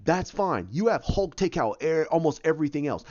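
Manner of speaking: ordinary talk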